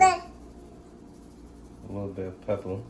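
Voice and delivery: slow drawn-out whisper; slow playful whisper over the pepper. Pretend. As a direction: breathe the words out slowly as a playful whisper. Drawn-out whisper while adding pepper.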